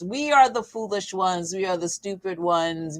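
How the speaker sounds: ordinary delivery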